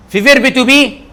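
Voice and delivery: ordinary talk, nothing marked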